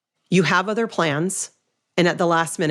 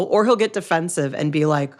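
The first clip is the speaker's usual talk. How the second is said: ordinary talk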